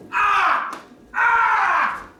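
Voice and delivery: high, sudden "ah" shrieks; very high-pitched acted yell; imitated scream. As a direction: shriek twice in mimicked alarm.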